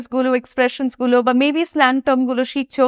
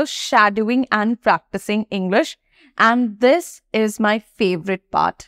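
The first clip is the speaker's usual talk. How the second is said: slow, deliberate teacher emphasis; 3 semitones lower, much slower than usual. Shown as a demonstration.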